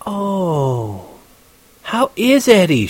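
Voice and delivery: slow, high, sociable woman; sweetly drawn-out feminine politeness; suddenly friendly. A narrator's reading. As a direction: coo with newfound interest. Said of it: "She was getting friendly as hell".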